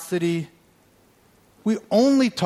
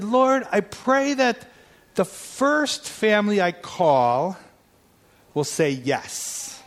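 slow pleading prayer; 4 semitones higher, slower than usual. Retold or imitated. Retold prayer, tired and pleading.

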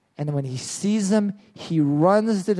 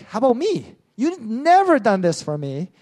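higher, aggrieved complaint; older son whines to his father, higher and aggrieved; jealous whine; 6 semitones higher than usual. Retold or imitated. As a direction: sulk like a slighted brother. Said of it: "the older son's like".

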